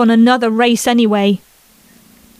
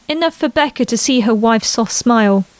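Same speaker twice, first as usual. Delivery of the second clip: ordinary delivery, nothing marked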